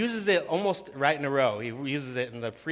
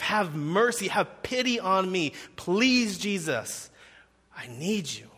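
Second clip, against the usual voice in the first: desperate crying out; 5 semitones higher, slower than usual. Acted. Ten lepers beg Jesus for mercy, desperate crying out. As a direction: implore with urgency, then let it drop. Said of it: "crying out with everything they have".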